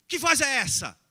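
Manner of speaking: loud, high, disbelieving question; incredulous shout. Acted. Neighbours challenging Abraham about the voice, loud incredulous shout. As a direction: fire it back in disbelief.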